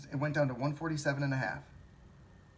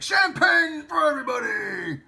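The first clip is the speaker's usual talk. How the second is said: much higher and louder, slow triumphant yell; ecstatic shout; 13 dB louder, slower than usual. Retold or imitated. Imitating rich shareholders bragging, a huge ecstatic yell. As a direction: crow it like a lottery winner. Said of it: "They're like".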